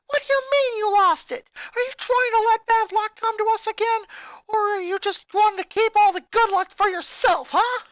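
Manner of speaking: high, loud accusing shriek. A narrator's reading. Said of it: "the princess accused".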